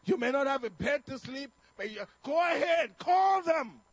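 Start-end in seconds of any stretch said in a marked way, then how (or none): 2.2-3.8 loud, higher shout; father loudly calling their bluff; retold defiant dare. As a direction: bellow a challenge at the kids. Acts out daring children to call CPS.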